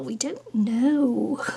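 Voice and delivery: slow, drawn-out shrug. Real, not acted. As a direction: stretch each word out slowly. Repeats with different delivery.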